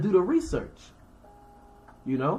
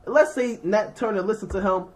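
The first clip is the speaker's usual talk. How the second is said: normal talking voice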